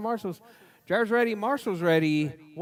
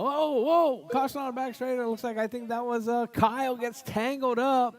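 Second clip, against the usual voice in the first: higher, quicker alarmed play-by-play as the cars tangle; excited crash call; 7 semitones higher than usual. Real, not acted. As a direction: react to the wreck, voice jumping up. Crash during live race call.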